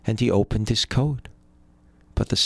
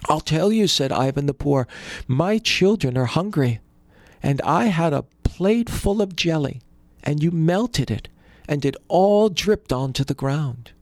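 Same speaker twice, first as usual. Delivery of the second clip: higher, earnest pleading voice; pleading poor brother; 6 semitones higher than usual. A narrator's reading. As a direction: plead your case, voice raised up.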